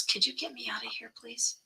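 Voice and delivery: a frail aunt whispers to be taken away; pleading whisper. Retold or imitated. Aunt whispering, begging her to get her out.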